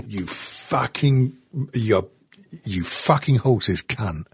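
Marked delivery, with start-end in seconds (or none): none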